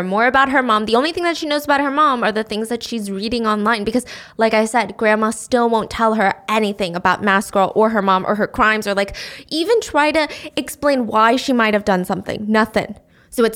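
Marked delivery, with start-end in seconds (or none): none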